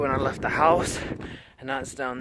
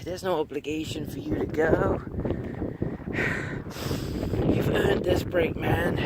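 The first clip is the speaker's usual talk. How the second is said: tempting inner voice; 3 semitones higher, 7 dB quieter than usual. Retold or imitated. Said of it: "that voice gets really strong".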